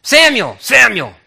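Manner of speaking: high, loud repeated summons; calling voice. Acted. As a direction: shout the name as a call. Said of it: "he hears again".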